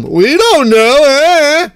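loud, slow, drawn-out dopey mimicry; exaggerated slow singsong mockery; mock clueless answer. Same mocking answer repeated.

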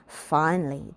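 ordinary delivery, nothing marked

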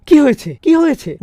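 high, bewildered cry from the grave; jolted up, asking what happened; startled waking. Acted. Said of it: "জেগে উঠে বলবে". (কবর থেকে জেগে উঠে হতভম্ব প্রশ্ন)

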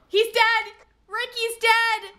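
high, loud, distraught wail; gasp, then wail it loudly; panicked cry. Real, not acted. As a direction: cry out the death in alarm. Teammate dies in game.

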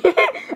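quick, high-pitched laugh; high giggle. Real, not acted. Gamer laughing after "get over yourself", high giggle. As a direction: let out a short high giggle. Amused after teasing a friend.

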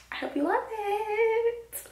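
high, slow, sweet sign-off; high sing-song. Real, not acted. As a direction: stretch it out, high and sugary.

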